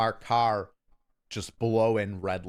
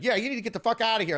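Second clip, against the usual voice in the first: fast, high-pitched mock scolding; irate girlfriend; 10 semitones higher, faster than usual. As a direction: imitate a woman joining in the row. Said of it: "His girlfriend's like".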